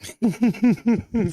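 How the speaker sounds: a sudden high burst of laughter. Real, not acted. Streamer cracking up at his own security plan, high laugh.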